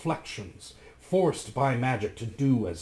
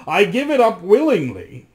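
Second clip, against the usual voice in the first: much louder and higher; loudly; 7 semitones higher, 10 dB louder than usual. Acted. Rasmus loudly declares he gives up his reflection willingly. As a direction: say it out loud for the watch to hear. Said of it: "he added loudly".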